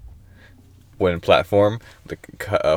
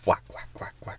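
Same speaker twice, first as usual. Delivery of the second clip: whispered; 13 dB quieter, faster than usual. Pretend. Joking whisper.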